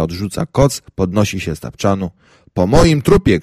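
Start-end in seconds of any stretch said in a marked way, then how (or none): 2.5-3.4 loud, higher outraged shout; over my dead body, shouted. A narrator's reading. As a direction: yell it as a flat refusal. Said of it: "krzyczę".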